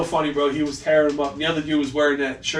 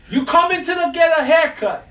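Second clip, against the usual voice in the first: high, louder indignant rant; ranting old Italian; 12 semitones higher, 5 dB louder than usual. Retold or imitated. Old Italian guy ranting about the customer, high and loud. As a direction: scold him up high. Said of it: "He goes".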